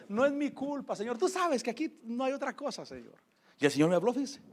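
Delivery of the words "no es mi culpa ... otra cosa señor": retold sheepish plea to God, higher and softer; joking excuse. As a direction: make light, half-joking excuses in a small voice. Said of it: "riéndome y excusándome".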